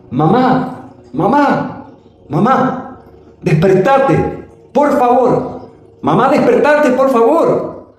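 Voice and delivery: high-pitched, drawn-out calling; retold shouting. Storyteller calling to wake sleeping mom, retold shout. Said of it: "Empecé a gritarle para que se despertara".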